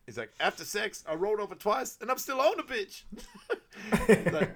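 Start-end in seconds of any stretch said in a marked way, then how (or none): 0.4-3.0 high delivery climbing into the punchline; imitated stand-up, pitched up; insult comic act. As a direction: perform it like a roast comic, laugh creeping in. Acted insult joke, building to punchline.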